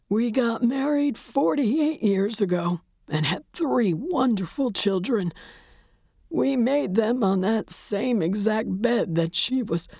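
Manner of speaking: put-on high, slow, mournful old widower; grieving old man. A narrator's reading. Widower tells the detective about young love, mournfully. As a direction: reminisce in a high, grief-worn voice.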